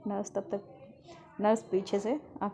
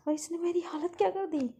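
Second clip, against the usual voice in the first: exasperated shout; 9 semitones higher than usual. Acted. Nurse storming out after the girl fought the injection, shouting. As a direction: burst out, fed up and frazzled. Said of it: "shout करते हुए बाहर आती है".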